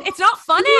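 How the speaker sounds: very high, loud child imitation; upset little girl. Viral little girl protesting the Sharpie prank, high loud imitation. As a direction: squeal it like an outraged little kid. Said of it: "kids with accents".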